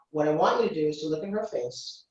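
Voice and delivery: normal talking voice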